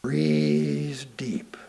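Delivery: breathy whispered cue. Acted. Meditation guide whispering a breathing cue, hushed.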